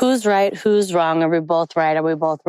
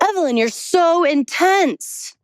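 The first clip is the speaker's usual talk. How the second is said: imitating an exasperated friend, pitched high; high, mock-frustrated accusation; 10 semitones higher than usual. Friend complaining to her that she is too intense, exasperated. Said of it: "he was getting almost, like, mad, upset, frustrated".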